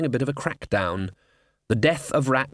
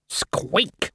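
squeak; 5 semitones higher than usual. Acted. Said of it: "the Death of Rats pointed out".

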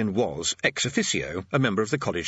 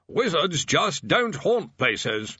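senior tutor voice; 6 semitones higher, much slower than usual. A narrator's reading.

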